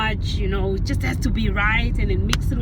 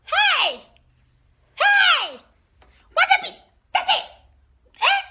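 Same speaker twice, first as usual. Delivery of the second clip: outraged mother shout; slower than usual. Acted. Mother shouting at daughter over bad tea, outraged. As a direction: blow up at the awful tea.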